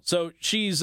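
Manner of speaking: ordinary talk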